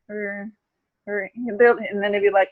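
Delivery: normal talking voice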